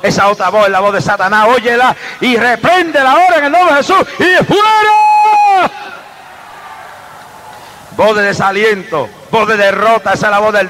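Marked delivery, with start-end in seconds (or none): 1.5-5.7 high loud preacher's shout; shouted rebuke. Real, not acted. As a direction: cry out the rebuke at full force. Reprensión gritada en culto. (grito de reprensión, agudo y fuerte)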